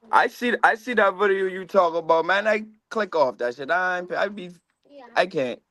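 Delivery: ordinary talk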